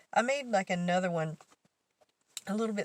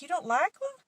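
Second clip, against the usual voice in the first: much higher, louder hurt question; mock insulted; 10 semitones higher, 4 dB louder than usual. Teller asks coworker about the paintings, mock insulted high voice. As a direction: play at being wounded. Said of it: "acting insulted".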